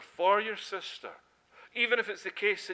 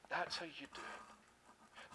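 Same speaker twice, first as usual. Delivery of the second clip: hushed aside; 12 dB quieter, slower than usual. Real, not acted. Quiet aside in sermon.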